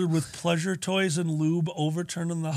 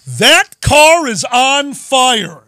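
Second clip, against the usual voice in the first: slow loud retelling; 6 semitones higher, 11 dB louder, much slower than usual. Retold or imitated. Wife's remark retold.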